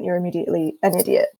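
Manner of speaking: ordinary talk, nothing marked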